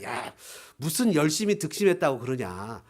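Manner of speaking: low, soft, lightly mocking tempter; devil's taunt. Devil taunting a believer at prayer, low belittling taunt. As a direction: voice a quiet, needling jab.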